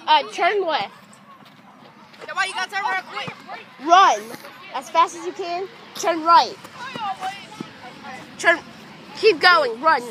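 loud commands called across a field; shouted commands. Real, not acted. Coach calling orders to the runner, loud shouts. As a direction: bark each order out loud.

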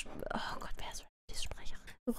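breathy excited whisper. Real, not acted. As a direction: whisper it to yourself, amazed. Wondering who voices Rarity.

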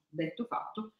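plain reading voice

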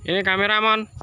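high sing-song coax; coaxing pet voice. Real, not acted. Owner showing pigeon the camera, high coaxing. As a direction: point the bird to the lens.

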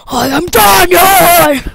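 slow, high, loud dramatic declaration; cinematic shout. Pretend. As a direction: bellow your name like a movie trailer hero. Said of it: "Cinematic voice!".

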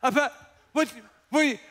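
high, sputtering disbelief; hammed-up flabbergasted outburst; stammering shock. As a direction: imitate a stunned wife stuttering up to a shriek. Acted shock at seeing husband indoors.

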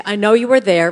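loud, firm and defiant; a bold, sassy stand against the critic; with attitude. Shown as a demonstration. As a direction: tell your inner critic off. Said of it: "Do this with an attitude".

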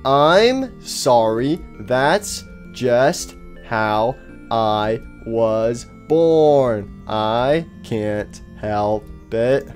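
very slow, drawn-out words; odd halting speech. Acted. Faceless stranger excuses his way of talking, odd halting speech. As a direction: stretch and separate every single word. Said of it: "why do you talk like that?".

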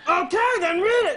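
hammed-up impatient order; exasperated forest ranger. Acts ranger losing patience.

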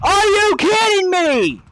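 high, drawn-out cry of disbelief; exasperated outburst. Real, not acted. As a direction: yell it at the screen in frustration. Raging at an online shooter.

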